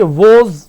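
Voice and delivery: वोज with a long "oh", like "vohz"; loud, low, stretched वोज; common mispronunciation. Shown as a demonstration. Teacher mimicking many students, mispronounced. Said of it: "बहुत से इसे ऐसे बोलेंगे".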